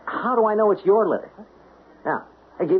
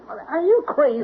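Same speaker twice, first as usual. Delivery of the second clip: alarmed; 9 semitones higher than usual. Acted. Mailman fighting off attacker.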